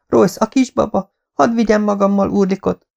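high-pitched urgent plea; pleading. A narrator's reading. Mother begging husband to let her take the baby, pleading. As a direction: plead high, without raising the volume.

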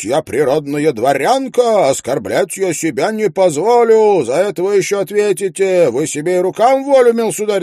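high, loud shrill tirade; shouting widow. A narrator's reading. Widow screams at neighbour over hurt pig, high and loud. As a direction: hurl every grievance at him. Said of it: "кричит".

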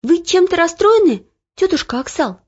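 put-on girl noticing the cook is upset; concerned girl. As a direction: ask the cook what's wrong. Olya asks worried.

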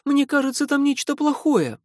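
very high, loud panicked shout; frightened cry. A narrator's reading. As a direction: cry out in alarm to stop him. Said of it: "Фи испуганно крикнула". (испуганный крик)

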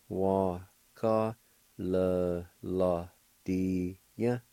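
Wa ka le la dia drawn out very slowly; very slow demo. Shown as a demonstration. Teacher slowing the word for learners. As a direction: stretch each syllable of Wa ka le la dia. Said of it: "It's pronounced like this".

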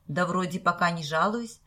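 usual speaking voice

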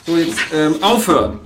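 from firm to a loud sharp command; scolding the cats. Acted. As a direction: raise the voice until they stop.

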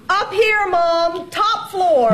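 high voice calling down stairs. Acted. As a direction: call down to mom far below. Calls down the stairwell.

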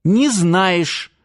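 high, contrary comeback; stubborn retort. A narrator's reading. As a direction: cut grandpa off stubbornly. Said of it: "упрямо отрезала Лера". (упрямо, высоким голосом)

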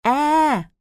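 a sudden high frightened scream; tiếng hét sợ hãi. A narrator's reading. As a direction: shriek out in fright. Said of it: "ngoác miệng hét lên một tràng". (tiếng thét chói tai vì hoảng sợ)

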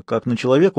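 ordinary delivery, nothing marked

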